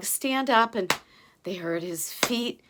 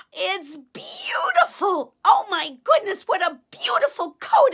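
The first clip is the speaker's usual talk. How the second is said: high, loud, awed delight; delighted gasp; 8 semitones higher, 5 dB louder than usual. A narrator's reading. Giant Og marvelling at his new coat, high and loud. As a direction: gush over the coat, breathless at first.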